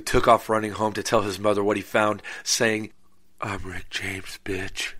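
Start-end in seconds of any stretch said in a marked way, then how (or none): 3.4-4.9 low, soft, understated delivery; hushed deadpan catchphrase. Acted. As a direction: drop the catchphrase quietly, as if stunned. Same catchphrase, now deadpan after finding body.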